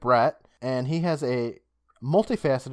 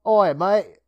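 loud, higher Aussie greeting; Australian accent; 8 semitones higher, 7 dB louder than usual. Pretend. Dared by his cohost, loud Australian accent. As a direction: bellow it like a brash Australian bloke. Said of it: "Let's hear it. Just do it".

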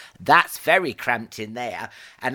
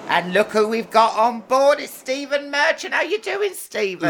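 excited greeting; 12 semitones higher than usual. Real, not acted.

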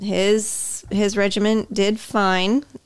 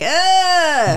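frustrated groan; 10 semitones higher, 5 dB louder than usual. Retold or imitated. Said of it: "And Scotty's like".

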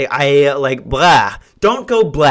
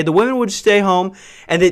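usual speaking voice

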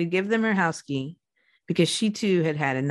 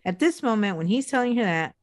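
normal talking voice

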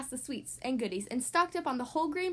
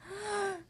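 shocked gasp; 6 semitones higher, 4 dB quieter than usual. Acted. Cubs shocked at the goodies.

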